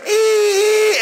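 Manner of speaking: very high squeal held on one note; translator's long eee. Retold or imitated. Translator keeping up with the preacher, long high squealed eee. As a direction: imitate an endlessly stretched emphasis sound. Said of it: "He's like".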